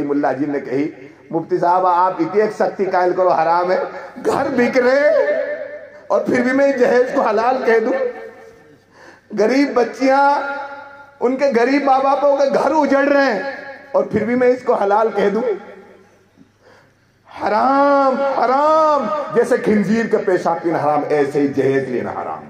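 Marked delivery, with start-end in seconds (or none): 1.3-15.4 rising impassioned preaching. Real, not acted. Preacher to congregation, impassioned rising appeal. Preacher argues against dowry. 17.3-22.4 shouted, drawn-out fiery verdict; preaching at full force. Real, not acted. Preacher condemning dowry to congregation, fiery shouting.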